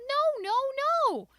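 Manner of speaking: put-on high loud child's no; a little girl yelling no. A narrator's reading. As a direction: shout it in protest. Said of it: "I yelled".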